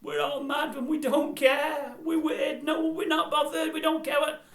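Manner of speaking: very high, loud manic mimicry; hammed-up, drawn-out zany voice; Rick Mail. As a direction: shriek it like a madcap comic. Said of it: "He just sounded like Rick Mail".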